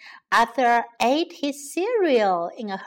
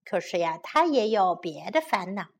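ordinary delivery, nothing marked

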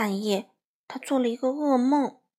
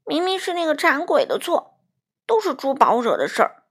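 aggrieved; 4 semitones higher, 6 dB louder than usual. A narrator's reading. Crow blaming the pig.

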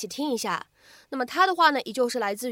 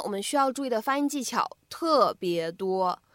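ordinary delivery

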